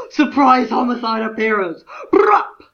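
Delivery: high, loud, excited fan yelp; excited squeal. Retold or imitated. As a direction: shout it like a thrilled fan. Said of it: "I got very excited on the floor".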